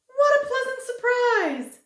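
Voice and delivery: very high, loud delighted cry; crying happily. A narrator's reading. Thin man greets visitors, very high and overjoyed. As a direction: squeal with joy at a visitor. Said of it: "he cried happily".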